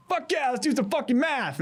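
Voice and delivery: high, hyped-up yell to a crowd; fired-up rally cry. Retold or imitated. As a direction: fire up the room. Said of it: "And you're like".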